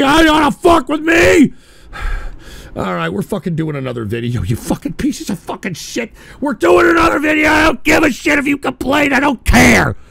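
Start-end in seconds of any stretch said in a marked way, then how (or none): 0.0-1.5 angry shout. Real, not acted. Shouting at viewers. 6.4-10.0 loud, high defiant yell; defiant shouting. Real, not acted. Streamer telling complainers he does another video, defiant shout.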